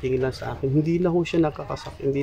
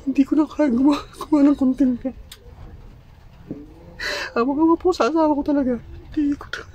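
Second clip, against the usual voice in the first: speaking through tears; high, breaking voice while crying; 10 semitones higher than usual. Real, not acted. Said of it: "umiyak ako".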